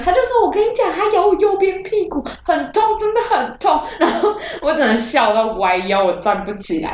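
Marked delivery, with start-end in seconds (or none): 0.5-3.8 high, laughing, insistent complaint; insistent sister. Retold or imitated. Sister insisting the shark bite hurt, high and laughing. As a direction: complain insistently, a bit whiny.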